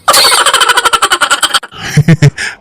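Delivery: high giggling laugh. Acted. Husband laughing high at his own teasing.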